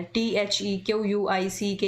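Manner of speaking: each letter said separately, T H E Q यू आई सी के; spelling letter by letter. Shown as a demonstration. Teacher reminding students to read letters, spelling them out. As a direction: spell it out one letter at a time. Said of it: "इस तरीके से आपको alphabets को पढ़ना है".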